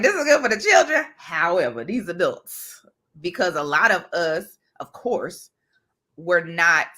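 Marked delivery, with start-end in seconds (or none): 0.0-1.0 high, laughing mock cheer; mock approval, laughing. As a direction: laugh it out like a proud kid. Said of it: "I was like".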